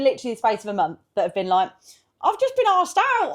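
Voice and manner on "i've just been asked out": a lightly voiced giddy friend, higher and slow; mock excited friend. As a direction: read it out like a giddy girl sharing news. Friend's excited messages quoted.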